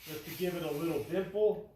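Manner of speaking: talking through a face mask; muffled voice behind a mask. Real, not acted. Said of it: "with my mask on".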